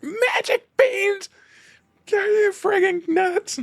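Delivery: high, slow, squawking Donald Duck yell. Retold or imitated. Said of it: "And Donald's like".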